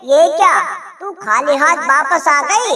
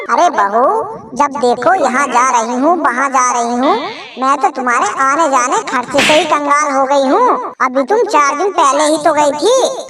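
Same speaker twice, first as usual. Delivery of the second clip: put-on lower, grumbling old mother-in-law; mother-in-law forbidding bahu's trip, lower nag; nagging mother-in-law; 3 semitones lower than usual. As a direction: nag about the costs.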